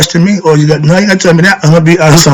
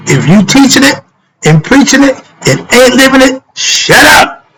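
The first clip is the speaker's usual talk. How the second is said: building higher and higher to a sharp shut up; rising heat, snapping the last words out; emotional outburst; 10 semitones higher than usual. Real, not acted. Preacher losing patience with hypocrites, building to an angry shut up. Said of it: "I'm a little emotional with that".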